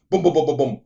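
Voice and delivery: loud mock gunfire booms; machine gun bursts. Retelling a film shootout, loud imitated gunfire. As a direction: fire off each boom like a machine gun.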